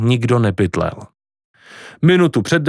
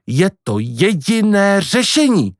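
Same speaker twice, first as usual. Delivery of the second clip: earnest, slow and higher statement; serious; 8 semitones higher than usual. Acted. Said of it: "řekla matka vážně". (vážně, důrazně)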